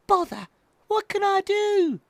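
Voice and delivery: put-on high, loud baby owl frustration easing into an idea; high-pitched little owl voice; thinking out loud. A narrator's reading. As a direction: exclaim it high, then settle. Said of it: "he thought out loud".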